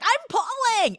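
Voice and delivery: very high, loud squeal; exaggerated high-pitched protest; mock straining yell. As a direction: yell back as if heaving with all your might. Acting out son's strained reply.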